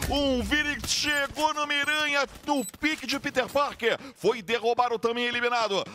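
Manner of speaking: high, fast hype call; excited call. Real, not acted. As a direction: hype the knockdown. Caster hypes elimination. (narração empolgada)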